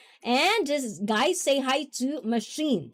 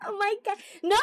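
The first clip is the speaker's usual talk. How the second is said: flustered squeal; 4 dB louder, faster than usual. Real, not acted. Flustered protest at chat teasing.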